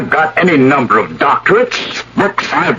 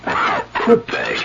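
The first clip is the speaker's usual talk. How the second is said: stunned whisper; 6 dB quieter, slower than usual. Acted. Shocked at being passed over.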